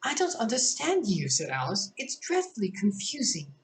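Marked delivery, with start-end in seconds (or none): 0.0-1.3 put-on higher, girlish Alice; a light girl's voice, pitched up. Reads Alice dialogue. 2.0-3.5 put-on higher, girlish Alice; a light girl's voice, pitched up. Alice dialogue.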